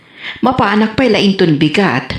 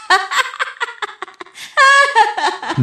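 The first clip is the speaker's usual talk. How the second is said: giggle. Acted. She laughs at his compliment.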